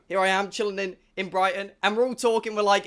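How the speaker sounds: ordinary talk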